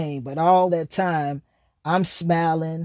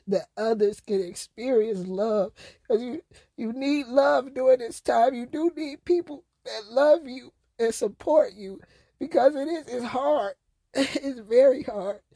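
tearful; 7 semitones higher than usual. Real, not acted. Emotional plea, tearful.

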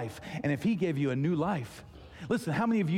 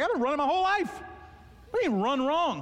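pitched way up, mock-outraged protest; indignant disbelief; 11 semitones higher than usual. Pastor retelling his reply to the running coach, high indignant disbelief. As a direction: throw it back at him, offended and incredulous.

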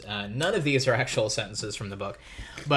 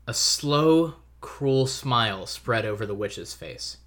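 big, bold storybook reading; story narration; 2 semitones higher, 4 dB louder than usual. Acted. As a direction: read it like an epic tale. Dramatic read-aloud of the book summary.

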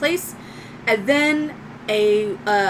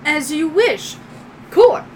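higher robot servant voice; obedient droid; 5 semitones higher, 4 dB louder than usual. Acted. Droid answering its owner's order, higher robotic voice. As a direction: acknowledge the order like a dutiful robot. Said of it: "Proto, we're working on the shield".

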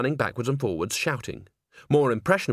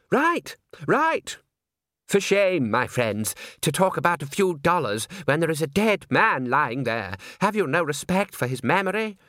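high, hammed-up moral outrage; indignant opera singer; 6 semitones higher than usual. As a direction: preach it like a tragic tenor. Performers haggle indignantly.

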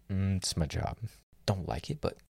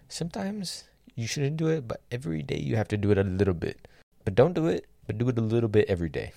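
higher, faster tongue-in-cheek advice voice, a bit louder; mock advice; 6 semitones higher, 4 dB louder than usual. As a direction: ham up the contradictions, light and silly.

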